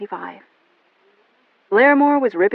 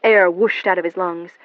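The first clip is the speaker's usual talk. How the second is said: usual speaking voice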